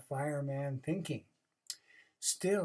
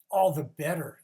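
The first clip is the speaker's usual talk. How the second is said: put-on louder, hearty old groundhog; wise groundhog; 11 dB louder than usual. Wise old groundhog answering the rabbit, loud. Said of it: "said the groundhog".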